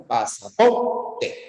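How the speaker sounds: "Pasaporte" said slowly, syllable by syllable; slow teacher demo; slow demo word. Shown as a demonstration. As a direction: spell out "Pasaporte" slowly for the class. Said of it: "pasaporte tiene cuatro sílabas".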